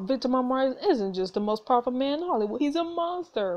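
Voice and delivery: ordinary talk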